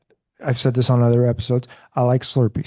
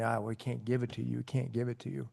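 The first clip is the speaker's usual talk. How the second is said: imitated refusal; 2 semitones lower, 12 dB quieter than usual. Retold refusal by store manager.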